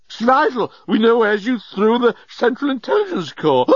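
high, slurred, urgent outburst; frantic character voice. A narrator's reading. Journalist presses Leovinus about the lost piece, frantic.

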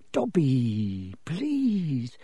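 drawn-out begging; pleading in dread; desperate plea. Acted. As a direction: beg helplessly, stretching each word. Harry begs the elf.

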